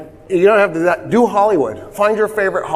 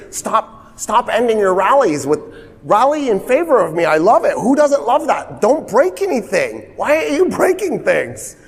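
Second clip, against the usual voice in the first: exasperated pleading; 7 semitones higher than usual. Retold or imitated. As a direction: pitch climbing much higher with each plea. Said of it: "keeps getting up in front of the country and going".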